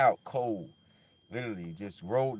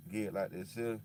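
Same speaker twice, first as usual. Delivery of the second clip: ordinary talk, nothing marked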